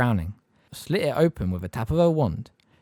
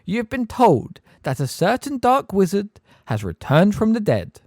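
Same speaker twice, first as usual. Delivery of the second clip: Umbridge; 10 semitones higher, 6 dB louder than usual. A narrator's reading. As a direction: spell it out with sugary menace. Umbridge lecturing the class.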